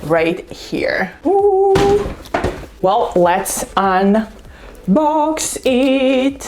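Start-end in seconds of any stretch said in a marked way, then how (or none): 1.2-1.7 high, loud, held ooh; admiring ooh. Real, not acted. Vlogger shows viewers the box, admiring ooh. 2.8-6.4 very slow, stretched words rising in pitch; drawn-out build-up. Real, not acted. Vlogger about to open her box, suspenseful drawl.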